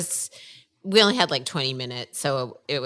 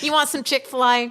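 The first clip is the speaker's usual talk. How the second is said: higher, sing-song Southern offer; Southern accent; 10 semitones higher than usual. Retold or imitated. Mother offering her son Chick-fil-A, surprise Southern accent. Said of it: "Apparently I have a Southern accent".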